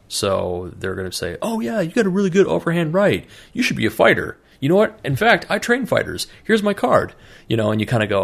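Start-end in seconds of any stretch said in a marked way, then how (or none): 1.4-7.1 much higher, mock-eager sales pitch; recruiting flatterer. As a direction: lay the flattery on thick, hammed up. Recruiter flatters him, hands card.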